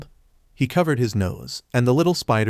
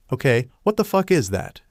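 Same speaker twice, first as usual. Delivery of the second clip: plain reading voice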